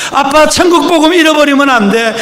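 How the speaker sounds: a daughter's pleading cry, stressing 천국; urgent tearful plea. Retold or imitated. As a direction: beg dad not to lose it, pressing on 천국. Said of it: "딸이 막 울면서".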